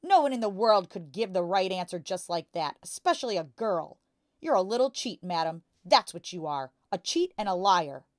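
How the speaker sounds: loud, relentless ranting; angry shout. A narrator's reading. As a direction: keep shouting her down. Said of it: "the father shouted".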